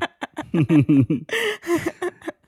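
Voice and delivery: a big real laugh, high and loud. Real, not acted. Laughing at dad's praise.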